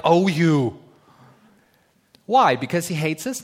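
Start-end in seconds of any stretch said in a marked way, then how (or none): 0.1-0.8 loud mock rebuke, fond; a fatherly oh you; God rebuking. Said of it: "we should hear God go like".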